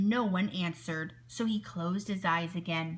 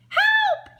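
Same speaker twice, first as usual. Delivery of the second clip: very high, loud cry; a real panicked scream; yelled for help; 10 dB louder than usual. A narrator's reading. Wolf truly scared of the boy, yelling. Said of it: "he yelled".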